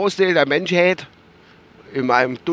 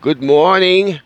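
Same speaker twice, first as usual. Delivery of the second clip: slow news anchor opener; announcer voice; 2 semitones higher, 3 dB louder, much slower than usual. Pretend. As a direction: announce the show slowly. Announcer-style show opening.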